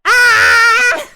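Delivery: very high, loud scream; shrill battle cry. Acted. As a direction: let out a piercing scream. Attack yell.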